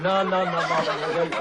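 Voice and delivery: ordinary delivery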